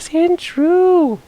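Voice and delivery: high, thrilled cry of the name; excited squeal. Real, not acted. Player spotting a wild Sandshrew, excited squeal. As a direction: squeal it like you just found treasure.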